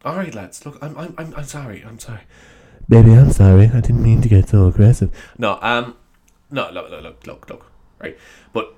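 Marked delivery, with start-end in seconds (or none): 0.0-2.2 much softer, backing down; hushed, apologetic climb-down; sheepish apology. Pretend. Mock apology after the rant. 2.9-5.1 mock lover's apology. Mock lover's apology, right on mic.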